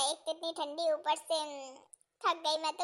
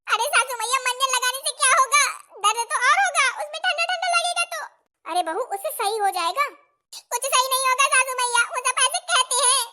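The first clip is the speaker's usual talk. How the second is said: put-on higher, louder fretting young bride; 7 semitones higher, 6 dB louder than usual. New bride alone in kitchen, fretting in high voice. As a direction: fret like a clumsy new bride. Bride argues with mother-in-law.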